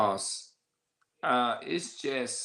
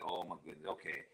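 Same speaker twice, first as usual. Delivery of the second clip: defeated sigh; 5 semitones lower, 13 dB quieter, faster than usual. Retold or imitated. Giving in after criticism.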